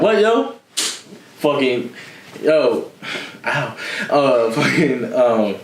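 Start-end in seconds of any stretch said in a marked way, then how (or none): 0.0-0.5 high, sharp "What, yo?"; Edwin's catchphrase. Retold or imitated. Imitating how Edwin warns before a fight. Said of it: "Edwin's like".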